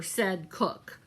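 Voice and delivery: ordinary delivery, nothing marked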